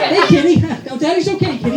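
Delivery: high, sweet pet talk; cooing to cat. Retold or imitated. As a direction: soothe the kitty. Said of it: "talking to cat".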